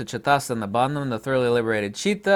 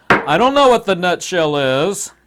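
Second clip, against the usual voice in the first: clueless student; 3 semitones higher, 7 dB louder than usual. Pretend. Imagined clueless student answering.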